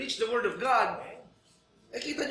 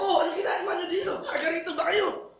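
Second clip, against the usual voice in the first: surprised greeting; 11 semitones higher, 4 dB louder, faster than usual. Retold or imitated. Surprised greeting of church members.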